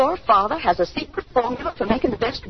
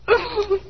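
hysterical laugh; 9 semitones higher than usual. Acted. Mother breaks down after her outburst.

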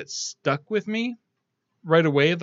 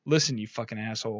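low, quieter imitation of a dad; lower-voiced mock dad; gruff-dad imitation; 6 semitones lower, 8 dB quieter, faster than usual. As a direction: cut him down as the blunt father. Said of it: "his dad just comes in and says".

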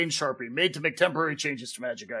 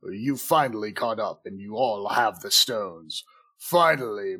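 sinister villain voice; 8 semitones lower, slower than usual. Acted. Hooded villain reveal.

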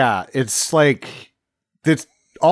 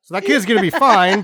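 ordinary talk

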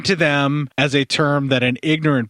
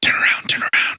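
whisper. Real, not acted. Whispered cue to cohost.

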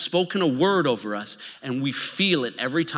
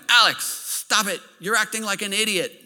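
put-on a stern teacher snapping at a kid, higher and louder; scolding teacher; 7 semitones higher, 3 dB louder than usual. Teacher scolding a nervous student in class, stern and loud. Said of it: "And he's like".

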